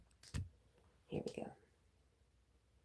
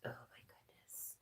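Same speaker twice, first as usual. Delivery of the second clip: whisper; 20 dB quieter than usual. Real, not acted. Cooing at the dog.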